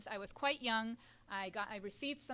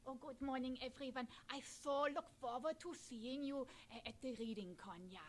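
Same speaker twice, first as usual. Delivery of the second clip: doll puppet voice; 7 dB quieter than usual. Acted. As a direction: greet the children as a sweet doll. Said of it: "So here we have Elsa".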